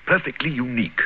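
usual speaking voice

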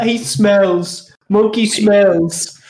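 high, slow, laughing taunt; sing-song teasing, up high. Pretend. Teasing muted friend.

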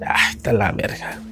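breathy whisper under the breath; whispered aside. Real, not acted. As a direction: mutter it to yourself in a whisper. Comentario en voz baja. (susurro para sí mismo)

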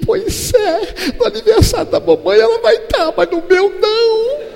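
father imitates his daughter crying over her birthday; mock sobbing child. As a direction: sob it out like a little girl.

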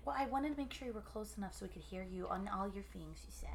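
fast unvoiced whisper. Real, not acted. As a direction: whisper it quickly. Adjusting mic, speaking softly.

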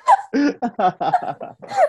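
long rising laugh; high laugh. Real, not acted. Laughing at the Guard joke. (mahabang tawa)

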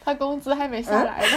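loud teasing laugh. Real, not acted. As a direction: crack up while teasing. Teasing friend while laughing.

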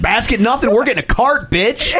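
imitating a hangry shopper. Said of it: "your hangry voice came out".

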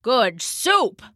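lightly voiced gruff Papa; a hint of grudging old man. A narrator's reading. Papa tastes Mama's soup, grudging approval.

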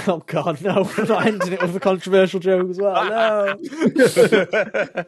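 laughing. Real, not acted. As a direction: laugh through the refusal. Laughing off a controversial joke.

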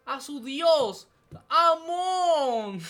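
booming hype introduction, high and loud; okrzyk zapowiadacza z Avatara. Retold or imitated. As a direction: proclaim a savior to a crowd, drawing out the final name. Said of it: "¿cómo era que decía el mancito este?".

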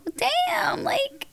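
high whispered disbelief; shocked whisper. Retold or imitated. Parent stunned by a child's honesty, in a high whisper. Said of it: "I'm like".

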